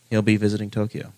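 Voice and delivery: ordinary delivery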